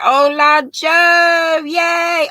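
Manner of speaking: slow, high, shouted welcome; happy shouted hello; cheering shout. Real, not acted. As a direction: call her name out like a cheer. Cheering a viewer's arrival.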